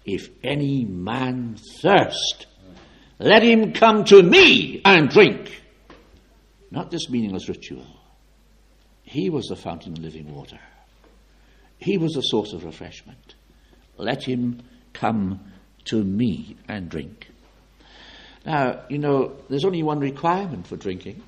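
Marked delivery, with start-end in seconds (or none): 0.0-5.6 slow proclamation building to a high, loud shout; loud solemn shout. Acted. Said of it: "suddenly shouted out with a loud voice". 14.0-17.3 unhurried, deliberate and unshouted; measured restatement with gaps between phrases; slow, measured quotation. Acted. Same quote repeated without the shout.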